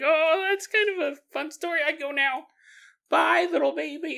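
put-on high, babyish chatter. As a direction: speak like a toddler waving bye. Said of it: "And the baby's like".

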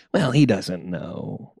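slow, soft Southern drawl, letting him off; a slow, soft forgiving Southern crowd. Retold or imitated. A crowd forgives the comic, in a slow soft drawl. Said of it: "I can feel crowds being like".

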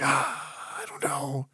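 unvoiced whispered doubt; whispering. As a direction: whisper it, unsure. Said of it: "he's like".

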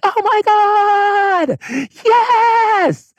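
very high, slow, drawn-out squeal of joy; over-the-top giddy cry; ecstatic squeal. Retold or imitated. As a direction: squeal like you can't contain it. Ecstatic outburst on lit train.

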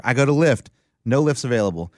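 ordinary talk, nothing marked

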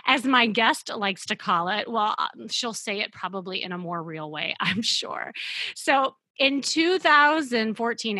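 ordinary delivery

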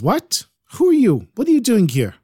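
put-on high, startled old woman demanding who he is. Startled old woman asking the woodseller who he is. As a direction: jump up in pitch, alarmed at the stranger.

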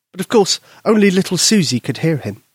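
plain reading voice

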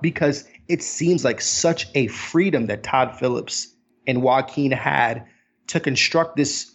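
usual speaking voice

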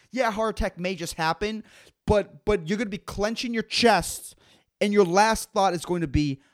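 ordinary delivery

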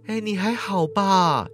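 slow, soft worried question; girl kindly asks the beaten man; kind concern. Acted. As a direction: gently check on him. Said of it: "好心的问道".

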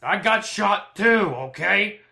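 high, slow, drawn-out protest; testy retort. Acted. Wounded doctor back to patient, testy high protest. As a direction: protest slowly, stretching the words.

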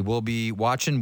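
ordinary talk

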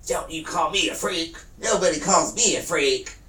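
hammed-up furious street rant; imitating an angry woman. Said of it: "just screaming".

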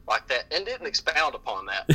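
normal talking voice

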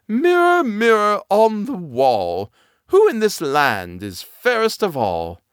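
high, slow queen calling her mirror; imperious queen, drawn out. Acted.

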